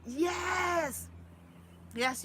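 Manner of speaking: high, loud yes; excited yes. Real, not acted. Vlogger agreeing with viewer, loud and high. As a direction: shout a happy yes.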